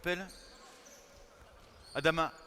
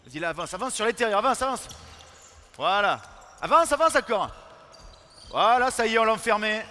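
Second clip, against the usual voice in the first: shouting instructions; 6 semitones higher, 11 dB louder than usual. Real, not acted. Coach yelling at defenders during drill.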